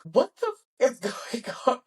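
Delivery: high, breaking into a laugh; half laugh, half panic; laughing disbelief. Retold or imitated. Asking the nurse in laughing disbelief, pitched high. Retold shock after placenta news.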